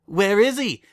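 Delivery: loud teasing singsong; playful hunting taunt. Acted. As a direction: tease while tickling a kid. Tickling, hunting the monster.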